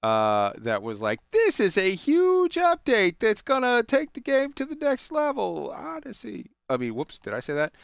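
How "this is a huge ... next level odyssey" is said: high mock-announcer voice, sarcastic; a high, sarcastic trailer voice; mock hype announcer. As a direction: send up the update's grand sales pitch. Mocking the update's hype pitch.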